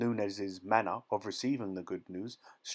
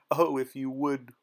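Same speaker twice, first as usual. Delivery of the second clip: raised, louder voice, narrated sob; sobbing plea; 4 semitones higher, 5 dB louder than usual. A narrator's reading. Woman begging her lover to consent, mock sobbing. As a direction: plead as if weeping, higher and louder. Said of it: "she sobbed".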